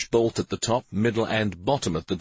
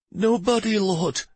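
trembling voice; 12 semitones higher than usual. Acted. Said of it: "answered in a trembling voice".

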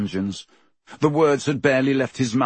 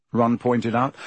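plain reading voice

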